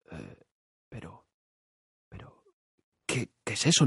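scared, near-whispered stammer; frightened hush. Acted. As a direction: gasp it out in fear. Sees giant head, frightened. (susurro asustado)

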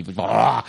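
long, drawn-out burst of noise; imitated whoosh roar. Fan acting out a film entrance, imitated roaring whoosh. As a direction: voice a huge arrival as sound effect.

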